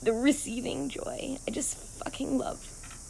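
slow, high, tearful whisper; real happy tears, whispered slowly; crying whisper. Real, not acted. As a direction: barely get the words out through tears. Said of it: "I sound like my Sicilian grandma".

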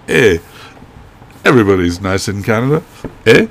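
a weak, half-hearted Canadian accent, fast; bad Canadian accent. Shown as a demonstration. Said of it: "I can't do a good Canadian accent at all".

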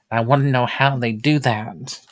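ordinary talk, nothing marked

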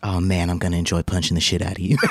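low, fast forties film patter; mock threat in Mid-Atlantic movie accent; old-movie accent. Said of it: "talk like they talked in the old movies".

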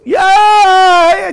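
high, loud worship yell of Yeah; joyful yell. Retold or imitated. Congregant shouting for joy, loud high yell. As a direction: belt it out like a fan of God. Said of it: "the person over here was".

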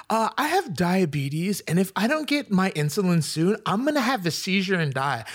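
imitated complaining driver. Driver pleading diabetes during the argument, lightly mocked complaint. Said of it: "And she's like".

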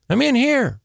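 high-pitched mimicked reply from far back; imitated wife calling out. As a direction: call out high, as the stuck wife. Wife's voice calling from crawl space.

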